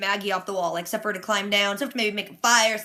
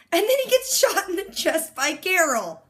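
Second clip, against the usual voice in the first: ordinary delivery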